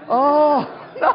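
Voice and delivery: high, loud, laughing disbelief; a delighted squeal of disbelief; half-laughing mock dismay at the offer. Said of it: "I'm like".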